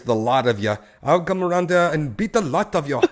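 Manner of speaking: loud, hammed-up British voice; British accent. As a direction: put on your best British accent. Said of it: "It's my best British voice".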